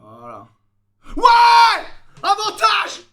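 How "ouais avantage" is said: sudden high cheer; excited shout. Real, not acted. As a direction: yell it out in delight. Cheering a rugby advantage.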